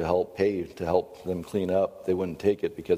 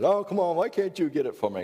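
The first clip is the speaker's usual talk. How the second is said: put-on high pleading kid voice; whining child; 11 semitones higher than usual. Child at dinner pleading with dad to fetch water. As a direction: whine like a kid who won't get up.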